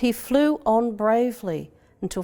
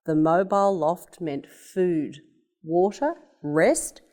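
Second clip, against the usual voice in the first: usual speaking voice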